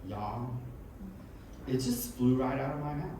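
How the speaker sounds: ordinary talk, nothing marked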